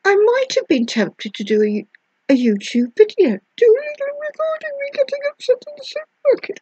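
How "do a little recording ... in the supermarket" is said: high, fast, whiny vlog voice; mock weepy vlogger. Mocking a weepy YouTube confession, high and whiny. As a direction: send up a teary video confession.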